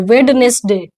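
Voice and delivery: "वेडनेसडे" as wed-ness-de, every letter sounded; common misreading. Shown as a demonstration. Teacher imitating how learners read, "वेडनेसडे" as wed-ness-de. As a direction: say "वेडनेसडे" in three syllables with the d sounded. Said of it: "आप लोग पढ़ते हो?".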